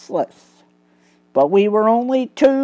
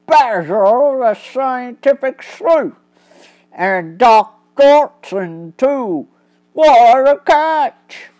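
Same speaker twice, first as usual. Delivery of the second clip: higher, louder, slow piratey villain voice; gloating pirate crook; 6 semitones higher, 4 dB louder than usual. Acted. Crook gloating at unmasking the detective, loud piratey glee. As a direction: crow over the catch like an old sea dog.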